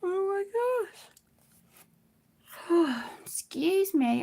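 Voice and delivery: ordinary talk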